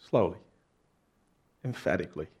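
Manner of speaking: usual speaking voice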